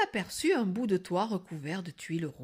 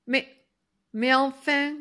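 high, loud, halting start; flustered protest; 6 semitones higher, 7 dB louder than usual. Acted. Stunned girl protests to the wolf, flustered. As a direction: stammer a little in disbelief.

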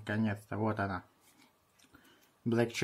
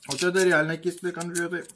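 excited exclamation; 6 semitones higher, 5 dB louder than usual. Real, not acted. Shocked by sourness.

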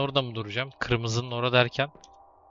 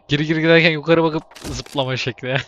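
laughing mock list; 3 semitones higher, 4 dB louder than usual. Real, not acted. Mocking list of jump tricks.

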